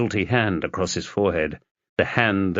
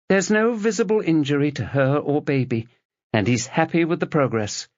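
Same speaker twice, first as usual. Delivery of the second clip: higher, calm professional nurse; 6 semitones higher than usual. Acted. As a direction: reassure him like a hospital nurse. Nurse voiced in the story.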